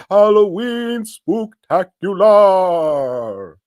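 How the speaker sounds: slow, loud, high ghostly announcement; spooky announcer. Acted. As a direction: draw out the title like a haunted host. Spooky show title announcement.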